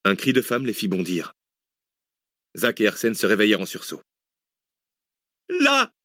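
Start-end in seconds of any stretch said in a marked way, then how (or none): 5.5-5.9 very high, loud narrated shriek; hysterical scream. A narrator's reading. Waitress points out the park scene, hysterical scream. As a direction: cry out hysterically, pitch leaping way up. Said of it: "hurlait hystérique la femme".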